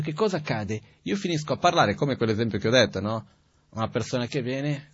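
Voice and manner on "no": ordinary delivery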